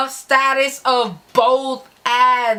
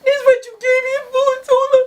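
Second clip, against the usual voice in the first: high, teary mock whine; mock crying; 13 semitones higher, 4 dB louder than usual. The crying boyfriend turning on the narrator, mock sobbing. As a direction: plead through fake sobs. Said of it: "he turned to me".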